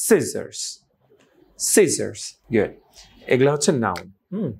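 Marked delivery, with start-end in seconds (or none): 0.1-0.8 "scissors" with SCI punched and a falling end; first-syllable stress. Shown as a demonstration. Teacher tells learners how we will say it, first-syllable stress. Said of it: "তাহলে আমরা বলবো". 1.6-2.3 "scissors" falling from a stressed SCI; first-syllable stress. Shown as a demonstration. Teacher repeats the model for learners, first-syllable stress. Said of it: "তাহলে আমরা বলবো".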